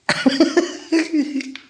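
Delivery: short high giggle; high laugh. Real, not acted. Owner laughing at stubborn pet, high giggle. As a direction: burst into a soft giggle.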